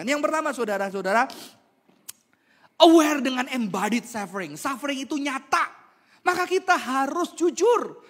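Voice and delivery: loud, high, driving sermon voice; fiery pulpit delivery; forceful preaching. Acted. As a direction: preach it hard to the congregation. Preaching at full volume.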